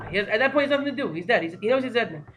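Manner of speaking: usual speaking voice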